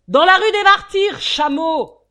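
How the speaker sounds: very loud, high angry yell; bawling. Acted. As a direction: bawl the insult at the driver. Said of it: "gueula Ragdal".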